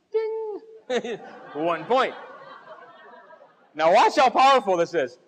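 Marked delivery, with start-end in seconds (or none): 0.1-1.2 high bell ding with a laugh; ding sound. Retold or imitated. Scoring sound effect.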